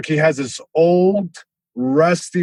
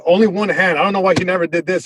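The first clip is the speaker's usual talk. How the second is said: normal talking voice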